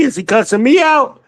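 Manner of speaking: sudden high cry of disbelief; a real jolt of alarm, voice jumping up. Real, not acted. Streamer asks chat if he's being cussed out, alarmed.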